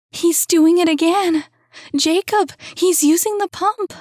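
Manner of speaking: high, loud terrified shriek; terror. A narrator's reading. Woman warning Jacob about the killer, terrified high shout. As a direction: panic rising with every word. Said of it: "He could only hear terror".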